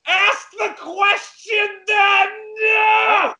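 high, slow, stretched-out shout; exasperated yell. Acted. Guest demanding the promised question, exasperated yelling. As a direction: holler each word at the stalling host. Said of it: "If you'd stop yelling at us".

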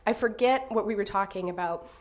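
ordinary talk

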